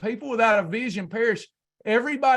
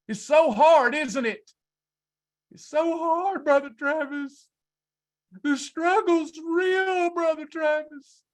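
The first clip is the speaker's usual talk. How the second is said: hammed-up self-pity, pitched high; mock whining; 7 semitones higher than usual. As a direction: whimper it like a spoiled complainer. Mocking complainers to Brother Travis.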